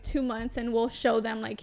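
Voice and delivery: ordinary delivery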